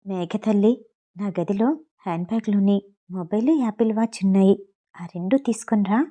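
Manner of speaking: put-on soft, low murmur into a child's ear; hushed words to a little girl; whispered aside. A narrator's reading. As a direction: whisper it close so no one hears. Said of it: "చెవిలో చిన్నగా చెప్పింది".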